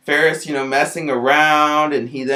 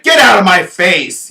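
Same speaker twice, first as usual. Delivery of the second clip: put-on loud, higher shout at the kid; retold yell; 5 semitones higher, 9 dB louder than usual. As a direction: bark him away in anger. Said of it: "he then yelled at me".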